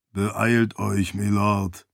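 low-key anxious servant, understated; a touch of fear, urging on. A narrator's reading. Ashe urging his lord to hurry, mildly anxious. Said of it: "rief Ashe voll Angst".

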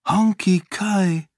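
high, slow, falling statement; drawn-out, confused wonder; bewildered. A narrator's reading. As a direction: say the name in dazed recognition. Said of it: "murmelte sie verwirrt".

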